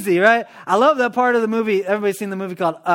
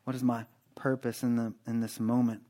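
hushed prayer; 7 semitones lower, 11 dB quieter than usual. Acted. Quiet personal prayer to God.

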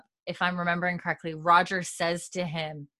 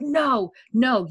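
retold yell; 5 semitones higher, 5 dB louder than usual. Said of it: "Bree yells at him".

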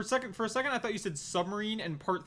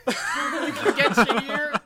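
laughing; 9 semitones higher, 5 dB louder, much slower than usual. Real, not acted. Laughing disbelief at friend's comparison.

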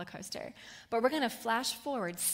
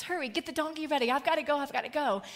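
higher, louder and fast, frantic urgency; frantic mother's yell; 5 semitones higher, 6 dB louder, faster than usual. Retold or imitated. Mother yelling at servants to ready the donkey, frantic and fast. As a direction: shout to the servants to hurry, racing the clock. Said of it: "she's yelling at her servants".

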